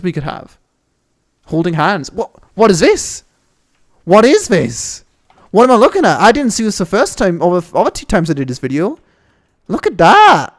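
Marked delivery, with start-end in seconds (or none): 2.1-10.5 high, loud, amazed outbursts; gasping with delight at the view; excited. Real, not acted. Streamer spots a new map detail, genuinely thrilled and loud. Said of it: "I'm getting a bit excited now".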